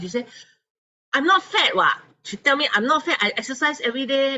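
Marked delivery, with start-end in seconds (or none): 1.1-4.4 indignant denial. Retold or imitated. Teacher denies being fat.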